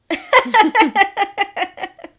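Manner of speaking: high burst of laughter; loud laugh. Real, not acted. Both laughing hard.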